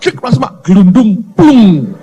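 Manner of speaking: animated comic sound effects; imitated tumble and splash. Father picturing his son rolling overboard, drawn-out mock splash. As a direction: act out the fall, ending on a drawn-out plop.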